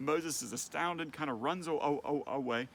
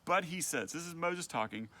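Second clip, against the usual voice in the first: ordinary talk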